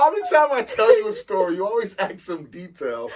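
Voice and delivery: high, shouted exasperation through laughter; exasperated outburst. Real, not acted. Host scolds co-host for always asking some detail, exasperated shout. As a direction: yell in real disbelief, half laughing. Teased exasperation at co-host's odd question.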